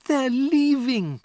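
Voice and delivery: put-on high, slow, loud child's cry; blurting the news; distressed girl. Matilda to Miss Honey, high distressed cry. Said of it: "she cried".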